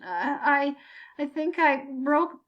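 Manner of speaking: slow, strained words through the pain; grunting in pain. A narrator's reading. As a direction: force it out past a broken leg. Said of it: "managed to get out between grunts".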